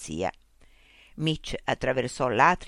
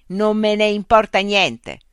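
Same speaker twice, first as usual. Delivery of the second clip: slow, louder furious retort; angry shout; 6 semitones higher, 9 dB louder than usual. Acted. Mitch snapping back at the machine. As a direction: spit it out slowly in anger.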